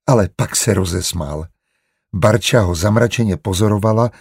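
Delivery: plain reading voice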